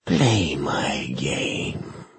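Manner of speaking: slow unvoiced demonic hiss; hissing whisper. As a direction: hiss it from below.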